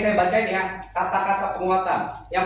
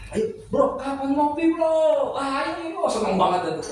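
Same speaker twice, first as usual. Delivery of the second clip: higher, bouncy voice of a friend inviting you out; eager buddy invite; 8 semitones higher than usual. Acted. Friend inviting his bro out for coffee, eager higher voice. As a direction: pitch it up like a pal begging for coffee.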